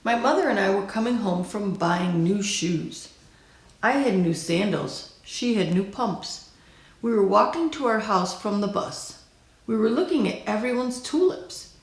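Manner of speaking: ordinary delivery, nothing marked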